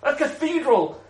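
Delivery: loud, high-pitched acted grievance; mock indignant complaint. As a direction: rant indignantly, pushing pitch and volume up. Acted complaint about cathedral spending.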